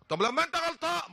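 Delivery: loud high retort, half joking; mock yell. Sheikh shouting back at the driver, playful mock yell. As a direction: raise your voice in mock outrage. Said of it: "انا بقى لازم ازعق".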